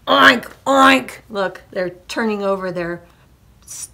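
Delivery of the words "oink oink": two very loud oinks; pig oinks. A narrator's reading. Pigs getting mad at sleeping farmer, oinking loudly. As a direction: oink forcefully, pitched up. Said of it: "the pig said".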